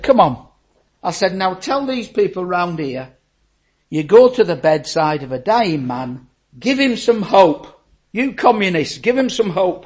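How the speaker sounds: loud, forceful open-air challenge; challenging shout. Retold or imitated. Preacher challenging a communist in the open air, loud. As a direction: call him out so the crowd hears.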